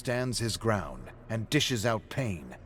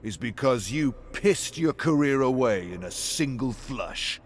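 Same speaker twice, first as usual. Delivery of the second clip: sneering fan; 2 semitones higher, 6 dB louder than usual. Acted. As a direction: spit the insult at him. Fan insults David in alley.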